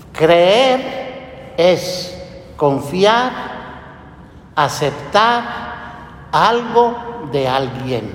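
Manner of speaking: very slow, weighty definition; solemn quote. Acted. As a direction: lay out each word solemnly. Cita la definición de Santo Tomás. (cita solemne y muy lenta)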